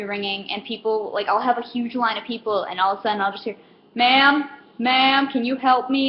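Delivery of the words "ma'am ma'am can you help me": slow, drawn-out calls for attention; customer calling out. Retold or imitated. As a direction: imitate a customer flagging down staff.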